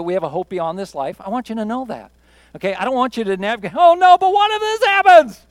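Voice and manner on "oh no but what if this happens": high, loud fretting cry; a hammed-up anxious "oh no"; mock panic. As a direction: play the worrier spiraling over what-ifs. Mimicking an anxious worrier.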